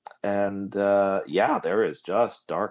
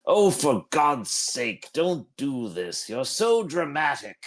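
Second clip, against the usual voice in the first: slow, fed-up scolding, higher; exasperated yell; 6 semitones higher than usual. Acted. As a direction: scold the goblet like a drama queen. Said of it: "begins to yell at, at the goblet".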